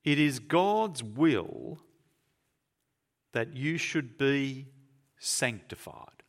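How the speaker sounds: very slow, each phrase set apart with pauses; solemn slow scripture reading; slow deliberate reading. Shown as a demonstration. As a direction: read it slowly, letting each word land. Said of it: "There's that word".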